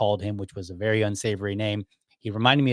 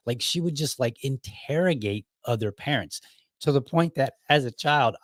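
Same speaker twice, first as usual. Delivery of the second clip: ordinary talk, nothing marked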